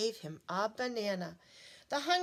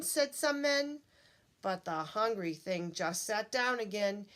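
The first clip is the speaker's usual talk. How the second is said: usual speaking voice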